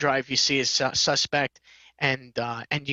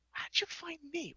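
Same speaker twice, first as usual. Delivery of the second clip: softer, slower, almost under the breath; hushed aside; 12 semitones higher, 14 dB quieter than usual. Retold or imitated. Asking the client how she found him, hushed and slow. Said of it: "I'm like".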